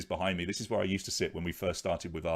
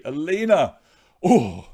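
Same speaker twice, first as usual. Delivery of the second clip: excited shout; 9 semitones higher, 13 dB louder, slower than usual. Real, not acted. Wheel stops just past Alina.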